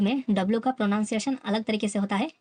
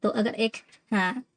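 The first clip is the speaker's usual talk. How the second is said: ordinary talk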